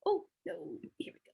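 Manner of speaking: ordinary talk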